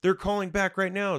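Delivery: imitating a scared victim; panicked friend. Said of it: "they're like".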